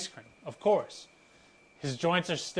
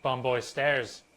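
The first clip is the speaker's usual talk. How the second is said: ordinary talk, nothing marked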